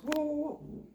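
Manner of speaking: high, loud run of whoas; alarmed protest. Acted. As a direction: stop the hunter in sudden alarm. Bear begs not to be shot.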